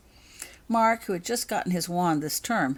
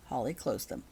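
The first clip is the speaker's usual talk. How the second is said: plain reading voice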